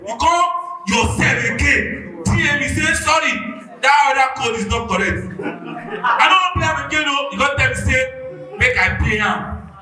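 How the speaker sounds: loud, high, aggrieved ranting; heated shouting rant. Real, not acted. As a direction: shout the whole grievance. Angry fan rants about codes.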